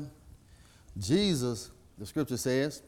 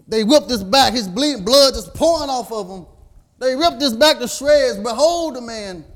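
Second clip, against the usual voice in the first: loud, high, pleading cry; preaching at full force; 7 semitones higher, 7 dB louder than usual. Acted. Preacher showing the crowd the beaten Jesus, loud cry. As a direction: make them see the beaten man.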